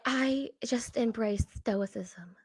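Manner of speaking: slow, hushed mock profundity. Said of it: "she tries to pretend to be deep".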